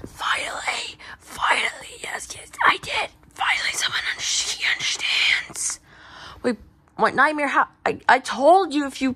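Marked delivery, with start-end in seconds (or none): 0.1-5.8 relieved, emphatic voiceless whisper; lost voice. Acted. Nightmare relieved someone finally understands, lost voice. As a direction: exclaim it with no voice behind it. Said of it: "Nightmare, you lost your voice?".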